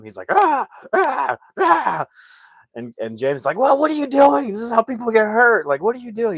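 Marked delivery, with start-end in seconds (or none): 0.3-2.1 very high, loud attack yelps; mock stabbing cries. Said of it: "pretending to stab him". 3.6-6.4 high, loud alarmed protest; freaking out. Retold or imitated. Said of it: "He's freaking out".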